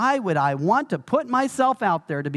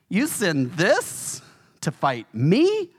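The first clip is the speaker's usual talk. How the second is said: slow, scornful giant's sneer; taunting Goliath; much slower than usual. Acted. Preacher voicing Goliath taunting David, slow sneering giant. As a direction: drag it out like a giant mocking a boy. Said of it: "Goliath laughed at him, ridiculed him, taunted him".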